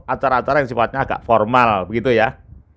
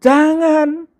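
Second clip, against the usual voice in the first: jangan said high and drawn out; a pleading high jangan; different intonation; 14 semitones higher than usual. Shown as a demonstration. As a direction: stretch jangan up high and long. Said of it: "kemudian Anda bedakan lagi, jangan".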